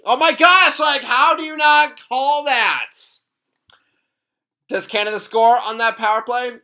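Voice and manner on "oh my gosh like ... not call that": outraged. Retold or imitated. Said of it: "where it's like".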